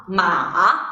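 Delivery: low dipping "ma"; "ma" falls, then rises; third tone. Shown as a demonstration. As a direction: drop "ma" deep, then curve it up. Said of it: "three tones sound in a row".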